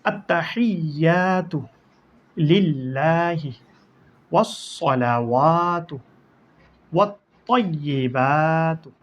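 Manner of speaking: each word drawn out slowly with clear Arabic sounds; slow careful recitation. Shown as a demonstration. Teacher reciting Tashahhud for students, slow and careful. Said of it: "উচ্চারণ তো পড়া শেষ".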